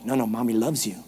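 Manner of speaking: low, soft reassurance; soothing mother. Retold or imitated. Mother reassuring a child who thinks she's mad, soothing. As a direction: drop right down and soothe.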